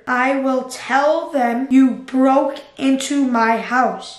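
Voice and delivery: slow, word by word; slow dictation. Real, not acted. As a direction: say it while typing it. Typing reply to Ryan.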